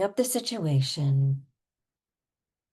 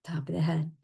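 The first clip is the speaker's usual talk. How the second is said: normal talking voice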